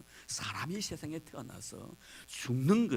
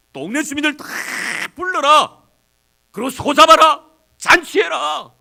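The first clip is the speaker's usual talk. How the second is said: much higher, much louder father calling out; overjoyed father yelling orders for the feast; joyful shout; 20 dB louder than usual. Acted. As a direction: shout for the whole village to come.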